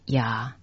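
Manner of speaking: "呀" said ya, as Taiwanese reads 夜; Taiwanese reading. Shown as a demonstration. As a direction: say "呀" as an open ya, Taiwanese-style. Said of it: "这个字台语念成".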